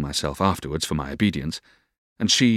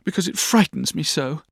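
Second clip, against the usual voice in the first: high, wheedling little plea; coaxing; 10 semitones higher than usual. A narrator's reading. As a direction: coax in a high girlish voice. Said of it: "said Dora coaxingly".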